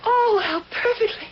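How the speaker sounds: high, swooning admiration; overwhelmed by the décor, breathless praise; gushing delight. Acted. Guest gushing over the hostess's room, swooning admiration.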